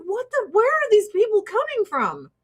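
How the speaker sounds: high, disbelieving outburst; high incredulous rant. Retold or imitated. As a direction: act stunned by everyone. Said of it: "I'm like".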